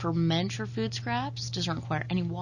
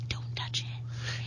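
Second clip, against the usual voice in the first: spooky whisper; 6 dB quieter, slower than usual. Pretend. Scary spaghetti warning.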